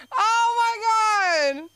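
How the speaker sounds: delighted shriek. Real, not acted. About her mom's text to the cousin, delighted shriek. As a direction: squeal it out.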